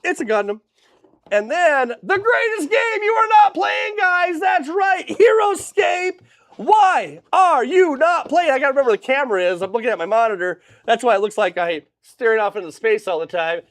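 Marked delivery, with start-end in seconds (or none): 1.3-8.5 hype shout. Acted. Hyping the Heroscape game.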